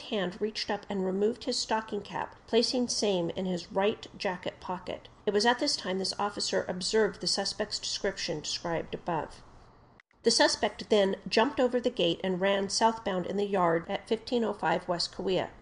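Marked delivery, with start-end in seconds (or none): none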